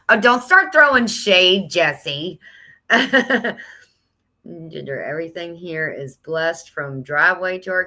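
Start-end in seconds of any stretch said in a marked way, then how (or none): 0.1-2.4 playful mock scolding, loud and high; teasing. Real, not acted. Teasing reply to a commenter. 2.9-3.7 bright burst of laughter. Real, not acted. Laughs after teasing.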